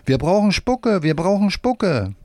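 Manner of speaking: put-on high-pitched children's cry, modest volume; children calling out. A narrator's reading. Children clamour at the water tub, high calling voice. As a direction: call it out high like a gang of children. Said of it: "die Kinder rufen nur".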